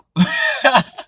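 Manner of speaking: high, loud burst of laughter. Real, not acted. Cracking up after trying an accent, loud high laugh. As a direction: crack up at your own impression.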